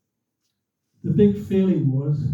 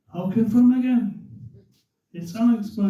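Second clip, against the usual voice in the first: higher announcer voice; radio commentator; 5 semitones higher than usual. Retold or imitated. Commentator confirming the winner again, imitated announcer. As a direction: imitate a commentator repeating the verdict. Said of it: "he said it again".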